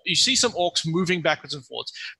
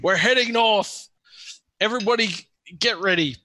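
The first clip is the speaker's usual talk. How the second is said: orc yell; 6 semitones higher than usual. Acted. Orc leader rallying everyone to head north, acted yell. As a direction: call out marching orders as the orc boss. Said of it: "the guy yells again".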